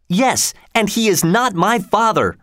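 high, insistent angry denial. A narrator's reading. Edward disowns Canty to Hugo, high-pitched and angry. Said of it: "said Edward angrily".

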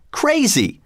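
very high-pitched exclamation; exclaiming. A narrator's reading. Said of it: "exclaimed Harrison".

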